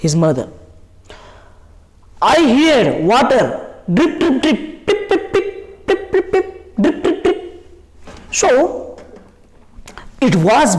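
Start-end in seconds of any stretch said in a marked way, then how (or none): 2.3-3.8 slow, stretched-out recital; dramatised slow report to a mother; snail telling mother. Acted. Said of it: "and explaining to". 3.9-7.6 tiny high-pitched drip-drip; high, light pip-pip water noises; imitated water drips. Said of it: "different, different sounds".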